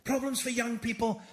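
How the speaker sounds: slightly high, mock-earnest phrasing; lightly voiced Cameron speech; mimicking Cameron. Mocking Cameron in Parliament, lightly voiced. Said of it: "Always goes".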